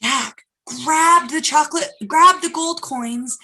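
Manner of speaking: plain reading voice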